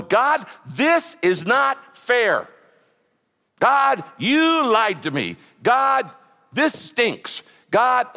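high, drawn-out complaint to God; impassioned, aggrieved wail of complaint; lamenting. Acted. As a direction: cry out in protest at God. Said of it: "Fifty of them are laments".

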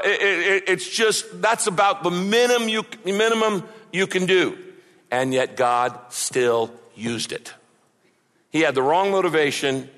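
ordinary delivery

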